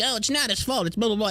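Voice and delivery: high, prissy mock voice; mocking commenter. Streamer mocks commenters defending Baldwin in a high mock voice. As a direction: mimic a silly defender.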